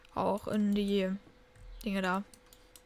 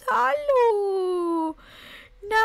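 much higher, louder cooing hello; 9 semitones higher, 5 dB louder, much slower than usual. Real, not acted. Greeting the newborn kittens, high baby talk. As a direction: coo at the newborns.

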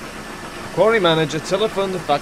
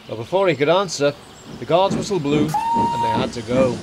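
plain reading voice

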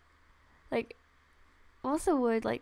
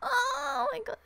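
much higher, squealed oh my god; delighted squeal; 10 semitones higher, 4 dB quieter than usual. Real, not acted. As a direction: gush like it's too cute to bear. Overwhelmed by cute pet.

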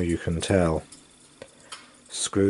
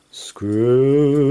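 high, loud, drawn-out ghostly wail; 6 semitones higher, 8 dB louder than usual. Acted. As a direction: wail his name like a haunting ghost. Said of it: "wailed the ghost".

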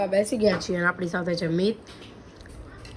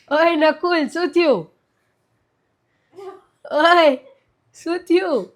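raised, higher call across the room to Nakul; 5 semitones higher, 6 dB louder, slower than usual. Real, not acted. Calling out to crying boy. (ઊંચા અવાજે બૂમ પાડીને બોલાવવું)

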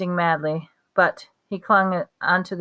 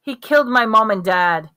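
roared; 3 semitones higher, 4 dB louder than usual. A narrator's reading. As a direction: bellow it out in grief and rage. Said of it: "Harry roared".

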